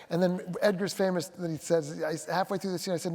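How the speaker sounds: normal talking voice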